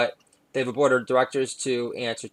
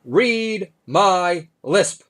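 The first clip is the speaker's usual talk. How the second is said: high, loud, very slow lisping voice; Russell Simmons impression; 5 semitones higher, 5 dB louder, much slower than usual. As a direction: drag out each word with a lisp. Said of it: "This is my impersonation of Russell Simmons".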